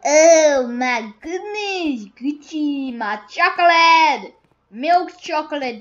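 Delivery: put-on high loud excited kid. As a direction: squeal over the chocolate. Thrilled at finding chocolate.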